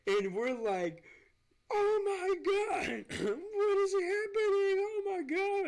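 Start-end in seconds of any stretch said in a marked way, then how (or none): 1.7-5.7 very high, loud, drawn-out panicked scream; terrified scream. Retold or imitated. Two roommates facing a burning oven, terrified screaming. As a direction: shriek it like the oven just exploded. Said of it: "just filled with fear".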